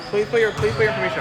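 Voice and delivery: usual speaking voice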